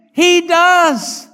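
loud, high pulpit shout; full-force preaching. Real, not acted. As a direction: hammer each phrase at the congregation.

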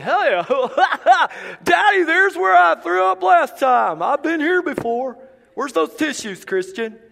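high, loud goofy dog voice, laughing, slow; silly dog voice. Retold or imitated. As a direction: play the dog proudly back at its vomit. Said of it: "And you're like".

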